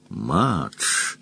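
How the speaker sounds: quiet menace, falling at the end; threatening. A narrator's reading. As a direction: say the name as a soft warning. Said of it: "с угрозой произнёс он".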